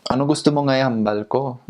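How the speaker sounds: Ano gusto mo nga ihambal ko with a rising, singing lilt; sing-song Hiligaynon. Shown as a demonstration. Showing listeners the Hiligaynon melody, sing-song rise. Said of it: "always up and down".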